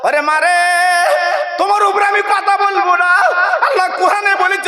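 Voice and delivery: high shouted cry; shouted lament. Acted. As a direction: cry it out to mother. Son's shouted vow to mother.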